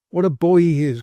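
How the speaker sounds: loud, fond exclamation; loud tender-hearted cry. A narrator's reading. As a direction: gush over the boy. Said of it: "cried the tender-hearted Poll".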